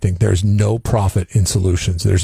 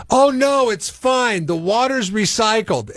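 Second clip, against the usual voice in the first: much higher airy mock woman's voice; breezy car washer; 13 semitones higher, slower than usual. Woman answers the nosy neighbor, high breezy mock voice. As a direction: toss it off lightly and sweetly. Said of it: "Her response to that was".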